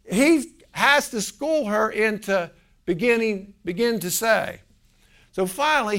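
usual speaking voice

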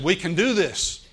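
loud, high cry of faith; bold declaration of faith. Retold or imitated. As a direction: declare it triumphantly.

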